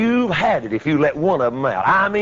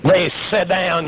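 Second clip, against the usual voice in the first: ordinary talk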